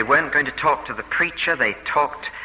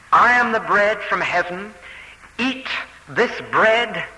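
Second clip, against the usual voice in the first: high, ringing emphatic proclamation; preacher voicing a bold, raised claim; 6 semitones higher than usual. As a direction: proclaim it firmly, pausing after the command. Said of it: "Jesus repeats his claims emphatically".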